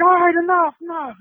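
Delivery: very high-pitched plea to stop; imitated pleading. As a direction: act out a friend begging to end a fight. Friend pleading to stop the fight.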